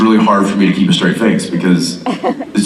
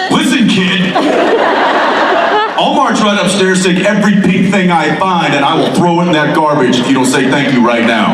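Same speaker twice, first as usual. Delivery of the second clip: higher, laughing fake-stern dad warning; mock stern dad threat; 8 semitones higher than usual. Dad half-jokingly threatens his daughter, mock stern with laughter.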